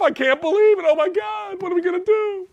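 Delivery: high, far mock wail; hammed-up panic cry; mock hysterical shriek.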